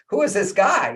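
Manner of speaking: sudden high, loud startled question; shocked at himself. Retold or imitated. Man startled by his own sudden anger, high loud disbelief. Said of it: "I'm like".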